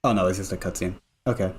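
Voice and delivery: ordinary talk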